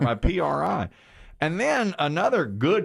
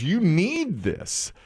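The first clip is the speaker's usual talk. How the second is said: higher, slow coaxing wife voice; higher, slow mock-gentle wife voice; imitating wife; 7 semitones higher, much slower than usual. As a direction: coax him slowly like a patient wife. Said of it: "She goes".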